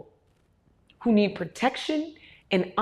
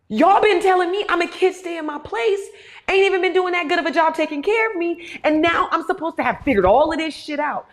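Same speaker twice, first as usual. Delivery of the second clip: indignant complaint; 7 semitones higher, 3 dB louder than usual. Retold or imitated. Voicing frustrated young adult.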